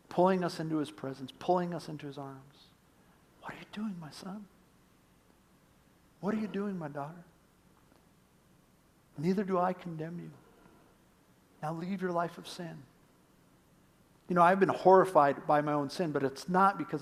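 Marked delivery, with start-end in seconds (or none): 3.4-7.2 soft, tender near-whisper; hushed voice of God; gentle. Acted. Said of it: "So gentle". 9.2-12.8 soft, tender pardon; hushed, kindly firmness; gentle conviction. Acted. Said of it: "his gentle conviction".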